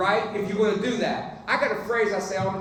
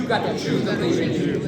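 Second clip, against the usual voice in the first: louder repeat to neighbor; 6 dB louder than usual. Shown as a demonstration. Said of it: "look at somebody at your table and tell them".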